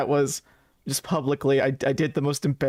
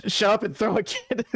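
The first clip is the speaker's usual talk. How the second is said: high-pitched playful order, about to laugh; mock bossy outburst; 8 semitones higher than usual. Player orders kid to throw cigarette, mock bossy.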